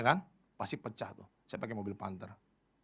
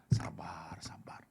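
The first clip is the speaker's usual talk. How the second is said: whispered, drawn-out self-calming; menahan marah; 10 semitones lower, 5 dB quieter, much slower than usual. Shown as a demonstration. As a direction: whisper it slowly to hold back anger. Contoh melatih sabar saat marah. (berbisik pelan menenangkan diri)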